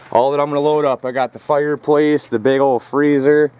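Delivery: normal talking voice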